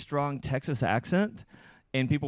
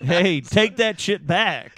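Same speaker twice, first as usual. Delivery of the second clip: Texas drawl; 5 semitones higher, 9 dB louder, slower than usual. Retold or imitated. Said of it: "I can talk like that".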